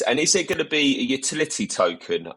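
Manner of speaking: normal talking voice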